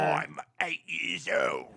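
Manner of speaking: very slow, eerie put-on voice; ghoul voice. Dad tries his ghoul voice again, very slow. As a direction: drag each word out like a ghoul. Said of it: "what do you think of that voice".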